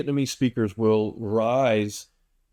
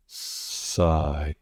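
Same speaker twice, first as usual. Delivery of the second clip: "Sigh" said low and falling, softer, like an actual sigh; 7 semitones lower, 3 dB quieter than usual. Shown as a demonstration. Tutor showing learners how "Sigh" falls off at the end. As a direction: let "Sigh" drop away at the end. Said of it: "sigh sort of reflects the sound".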